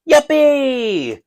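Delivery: high, drawn-out yippee; mock Montreal cheer. Solo player corrects the Montreal crowd's cheer, mock cheer. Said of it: "that's how they say yay".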